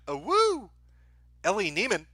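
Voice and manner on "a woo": a high, whooping cheer; a woo cheer. Pretend. Streamer giving chat the requested woo, high whoop. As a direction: let out a big woo for chat. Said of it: "Can we get an a woo for chat".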